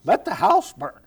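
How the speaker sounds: stern retort. Acted. Preacher answers the distraction voice.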